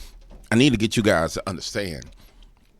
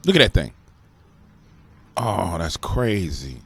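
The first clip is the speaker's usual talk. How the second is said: amazed; 3 semitones lower, slower than usual. Real, not acted. Amazed watching thermal footage.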